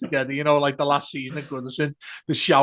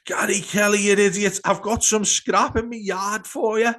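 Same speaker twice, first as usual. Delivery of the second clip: mock heckle; 8 semitones higher, 3 dB louder than usual. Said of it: "some fella from the back go".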